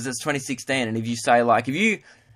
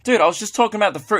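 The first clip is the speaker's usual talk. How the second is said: fast, higher defensive retort; quick, high-pitched pretend exasperation; mock protest; 6 semitones higher, faster than usual. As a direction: rush out a baffled objection. Protests the lecture.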